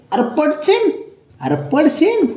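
very high, rising warning cry; high-pitched fussing caution; alarmed warning. Real, not acted. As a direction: warn a pet it will fall. Warning the pet it will fall.

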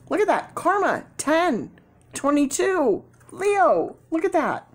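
high-pitched, excited exclamations; thrilled amazement. Real, not acted. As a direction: call out each match in rising delight. Thrilled that the cards match up.

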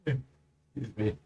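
slow, drawn-out yawn while speaking. Real, not acted. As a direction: talk through a yawn. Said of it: "I'm yawning just because I'm really dang tired".

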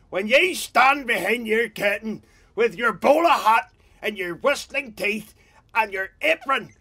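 high, loud, slow outraged tirade; hammed-up affronted yelling; indignant mock shout. Man shouting back at the loyalist, slow and outraged. Retorting man across the street.

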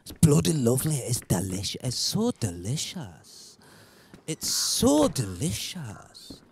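a put-on Scottish accent, drawing out "so delicious". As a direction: praise it in an attempted Scottish accent. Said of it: "start with the Scottish accent".

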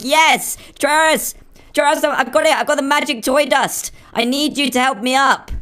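high, excited call to a friend; play-acted jubilant shout for help; triumphant calling out. Calling up to Travis with find.

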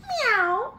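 high little meow; high-pitched cat noise. Acted. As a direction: mew like a storybook cat. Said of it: "said Cat".

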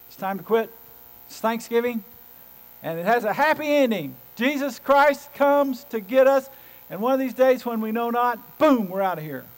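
loud preaching, slowing on "Jesus Christ comes"; full-force preaching. Acted. Pastor closing the Thanksgiving sermon, loud triumphant preaching. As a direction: build to a triumphant close.

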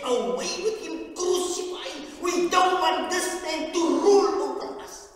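high, loud, chanted cry of the angry crowd; crowd's cry. Retold or imitated. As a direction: shout it as the mob demanding crucifixion. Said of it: "they said".

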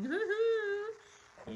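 high, loud woo-hoo cheer; excited cheer. Real, not acted. Host cheering to viewers about Christmas, excited whoop. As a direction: whoop it out with delight.

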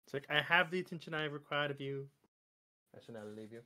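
ordinary delivery

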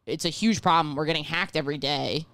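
ordinary delivery

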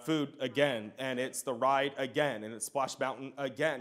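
mock unimpressed sigh of repetition; jaded. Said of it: "It's like".

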